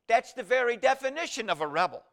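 loud, high declaration; a pastor's forceful punchline; preaching emphasis. Acted. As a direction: proclaim it from the pulpit.

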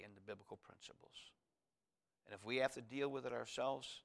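soft, fading prayer voice; hushed pastor's prayer; closing prayer. Acted.

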